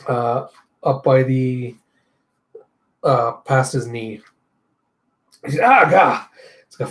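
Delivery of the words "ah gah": loud, high strangled yell; pained grunt cry. Retold or imitated. Fighter cutting off his leg, crying out. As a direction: cry out as the leg is cut away.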